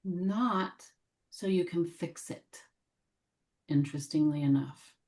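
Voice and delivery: measured meditation voice, long silences between phrases; gently invite, leaving room after each phrase; guided meditation. Real, not acted. Guide welcoming what needs healing, gentle meditation voice.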